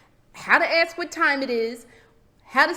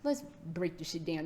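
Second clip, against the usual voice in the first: ordinary talk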